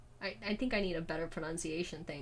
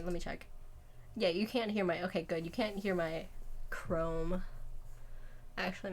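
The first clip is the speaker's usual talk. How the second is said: usual speaking voice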